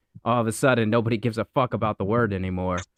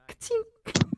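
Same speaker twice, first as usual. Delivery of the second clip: cymbal rim shot sound; 7 dB quieter than usual. Shown as a demonstration.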